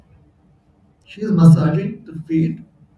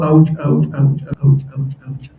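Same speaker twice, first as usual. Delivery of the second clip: chanted ouches; 3 semitones lower than usual. Retold or imitated. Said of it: "Is this a new mantra".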